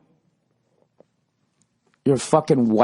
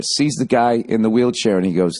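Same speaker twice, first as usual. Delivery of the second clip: normal talking voice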